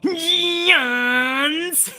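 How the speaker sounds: high, drawn-out ring announcer bellow; Justin Roberts impression. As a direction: belt it out like an arena announcer. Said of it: "how does he do Justin?".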